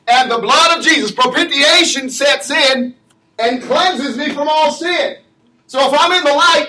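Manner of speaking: fervent preacher climbing toward a shout; preaching at full force. Acted. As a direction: stir the congregation.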